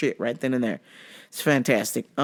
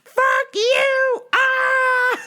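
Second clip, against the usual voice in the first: high screamed insult and wail; mock yelling; 3 dB louder, much slower than usual. Customer cursing the boss, mock yelling. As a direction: screech the curse into a scream. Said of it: "She was yelling".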